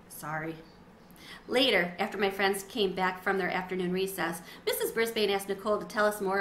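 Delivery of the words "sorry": much softer, lower sheepish apology; embarrassed sorry. A narrator's reading. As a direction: shrink into the word, ashamed. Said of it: "Sophie looked embarrassed".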